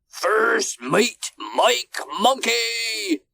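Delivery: high, drawn-out call across the deck; captain's shout. A narrator's reading. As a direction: bellow out orders slowly as a ship's captain. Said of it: "he shouted".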